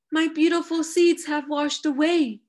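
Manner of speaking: high, upset storybook wail; sniffling dismay. A narrator's reading. Badger to his friends about lost seeds, sniffling dismay. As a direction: lament it high, close to tears. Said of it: "Badger sniffled".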